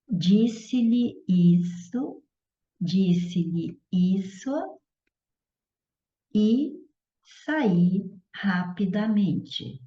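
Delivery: stretched words with long pauses; slow dictation. Shown as a demonstration. Teacher giving a pronoun example, slow dictation. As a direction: say it slowly, as if writing along.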